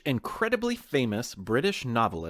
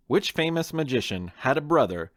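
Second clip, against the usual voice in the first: ordinary delivery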